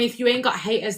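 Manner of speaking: ordinary talk, nothing marked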